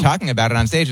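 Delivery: ordinary talk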